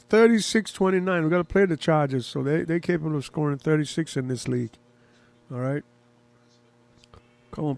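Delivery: ordinary talk, nothing marked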